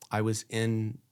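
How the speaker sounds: usual speaking voice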